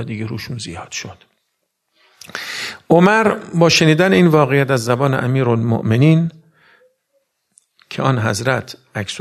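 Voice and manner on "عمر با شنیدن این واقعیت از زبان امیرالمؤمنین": louder sermon delivery; the preacher raising his voice on Umar's boldness; rising preaching. Acted. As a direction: push the story forward with force. Preaching climax.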